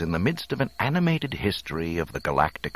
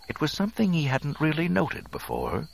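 ordinary delivery

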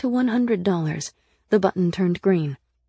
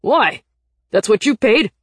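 furious; 3 semitones higher, 10 dB louder than usual. A narrator's reading. Nick challenges his companion over the rejected payment, furious. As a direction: demand an answer in outrage. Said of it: "he read in a furious voice".

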